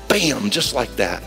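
ordinary delivery

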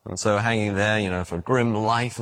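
normal talking voice

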